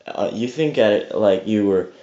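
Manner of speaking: ordinary delivery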